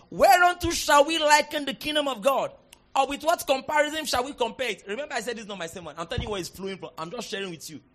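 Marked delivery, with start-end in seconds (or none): 0.1-4.8 raised, solemn Bible reading; scripture reading. Acted. Pastor reading Mark to congregation, formal. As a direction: read the verse formally.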